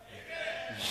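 shouted amens from congregation. Real, not acted. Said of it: "Yeah, I like that".

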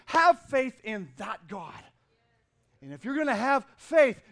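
loud, rising preacher's call about God; preaching. Acted. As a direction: build the congregation up with full-force preaching.

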